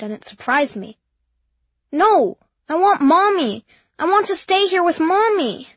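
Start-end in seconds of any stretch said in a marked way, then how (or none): 1.9-5.7 put-on high, loud childish wail; baby voice shouting. A narrator's reading. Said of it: "sounded like a baby voice".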